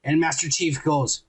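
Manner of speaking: ordinary talk, nothing marked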